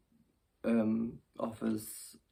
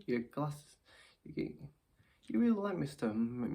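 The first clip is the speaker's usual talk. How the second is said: usual speaking voice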